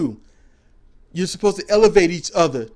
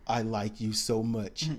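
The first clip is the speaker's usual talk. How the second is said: soft quoted line; 9 semitones lower, 10 dB quieter than usual. Retold or imitated. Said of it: "not her daily speaking voice or tone".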